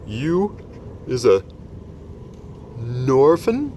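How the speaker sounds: giant amazed she is an orphan; surprised giant. Acted. BFG surprised.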